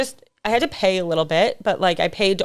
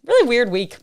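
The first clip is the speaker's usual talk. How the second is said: ordinary talk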